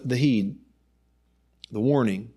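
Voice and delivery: normal talking voice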